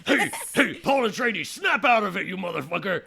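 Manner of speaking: loud, rough snap-out-of-it yell; Gurney Halleck. Acted. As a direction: bark at him to wake up. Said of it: "says Gurney Halleck".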